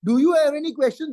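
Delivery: brisk, perfunctory check; rushed token question. Shown as a demonstration. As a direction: ask it hurriedly, not waiting for an answer. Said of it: "Then they go on speaking".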